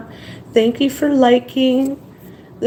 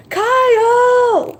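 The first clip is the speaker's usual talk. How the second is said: long high call, as across a house; 12 semitones higher than usual. Shown as a demonstration. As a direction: call the name out to someone far off. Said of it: "like calling for you".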